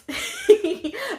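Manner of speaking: high giddy laughter. Real, not acted. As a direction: laugh with joy. Happy to be home.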